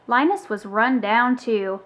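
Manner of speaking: ordinary delivery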